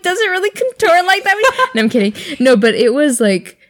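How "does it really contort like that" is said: high, teasing disbelief; mock disbelief. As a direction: gawk at a friend's crying face. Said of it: "I was like".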